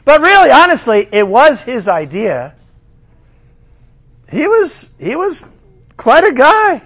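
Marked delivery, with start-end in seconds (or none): 0.0-1.1 raised, pushed mock sincerity; sarcastic emphasis; mock earnest. Mocking the king. 4.3-6.8 high mock-admiring tone; mock admiration; sarcastic praise.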